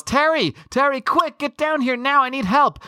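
mock yell. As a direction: call Terry down, mock panic, pitched high. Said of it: "Wanda yells".

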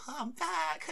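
put-on very high sing-song girly voice; high playful girly voice; imitating the girl. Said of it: "she's like".

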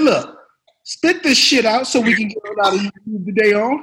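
loud, high, impatient pep talk; Sam Jackson. Retold or imitated. Star urging a nervous rookie through the scene, impatient. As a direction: imitate a star pushing a rookie onward. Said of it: "He said".

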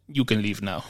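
put-on low, soft, vaguely Russian-accented dismissal; botched foreign accent, dropped low and quiet. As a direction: imitate her with a rough Russian-sounding accent. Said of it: "In her, in her Russian accent".

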